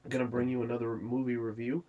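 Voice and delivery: ordinary talk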